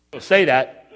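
slow words through a real chuckle; laughing. Real, not acted. Preacher warns the men after his joke, laughing. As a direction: say it while laughing.

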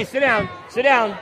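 firm call. Real, not acted. Calming dancing children.

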